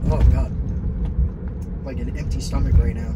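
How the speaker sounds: talking through hiccups; hiccuping between words. Real, not acted. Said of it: "I have the hiccups".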